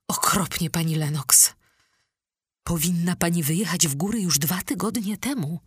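ordinary delivery, nothing marked

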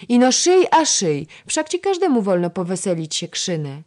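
loud, high-pitched cheeky protest; insolent grumbling. A narrator's reading. Lady-in-waiting complaining about endless sewing, insolent. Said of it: "zuchwale odburknęła Baśka".

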